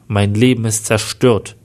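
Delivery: usual speaking voice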